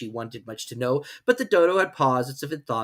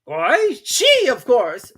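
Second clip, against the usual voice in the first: very high, loud, slow pronouncement; grand Dodo; 12 semitones higher, 10 dB louder, much slower than usual. Acted. Dodo deciding the race, high loud and slow. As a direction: declare it grandly. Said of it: "said the Dodo".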